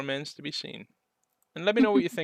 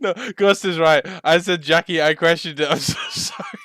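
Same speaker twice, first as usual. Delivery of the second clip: laughing; 4 semitones higher, 8 dB louder than usual. Real, not acted. Laughing at own mistake.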